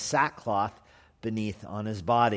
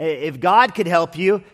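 raised, exasperated sarcastic challenge; sarcastic king refusing to help; 4 semitones higher, 4 dB louder than usual. Acted. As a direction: fire rhetorical questions back at the pleader. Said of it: "kind of as a sarcastic expression".

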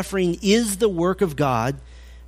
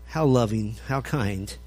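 dry sarcasm; 6 semitones lower, 5 dB quieter than usual. Real, not acted. Ironic remark on Bildad's reply.

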